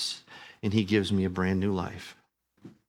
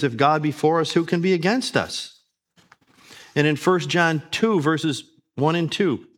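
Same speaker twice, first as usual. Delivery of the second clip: scripture reading; 3 semitones higher, 5 dB louder than usual. Acted.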